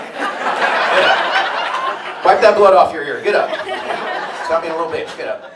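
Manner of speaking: put-on harsh loud yell at a kid; dad yelling. Dad yelling at his son to get up, harsh and loud. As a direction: bark at him to get up.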